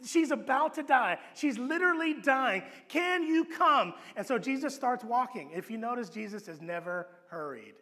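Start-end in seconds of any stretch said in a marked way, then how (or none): 2.9-3.9 slow, high drawn-out plea; pleading father. Retold or imitated. Father urging Jesus to come, slow high plea. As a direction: stretch it out like a desperate father.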